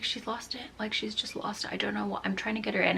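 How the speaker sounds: tired, soft whisper of exasperation; near-voiceless, worn-out reply. Retold or imitated.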